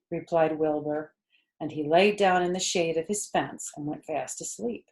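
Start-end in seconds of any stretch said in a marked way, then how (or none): none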